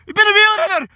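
hoog uitgeschreeuwd, dan lachend; schreeuwend. Real, not acted. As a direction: schreeuw het lachend uit. Wielrenner klaagt schreeuwend. (schreeuwend en lachend)

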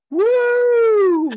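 high loud cheering woo; excited whoop. Retold or imitated. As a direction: whoop like a thrilled fan. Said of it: "and Oz going".

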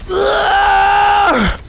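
sharp high strained grunt; effort grunt. Real, not acted. Player straining at a tough move, high grunt. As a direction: heave it out like lifting something heavy. Struggling with a hard game move.

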